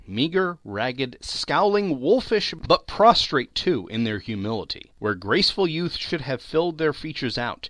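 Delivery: usual speaking voice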